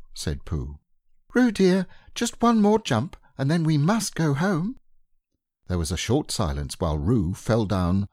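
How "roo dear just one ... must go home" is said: high, gentle mother kangaroo voice; high motherly Kanga. A narrator's reading. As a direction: coax like a fond mother kangaroo.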